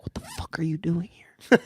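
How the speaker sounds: whispered in surprise, under the breath; hushed whisper. Retold or imitated. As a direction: hiss it so the date can't hear. Roommate startled at restaurant.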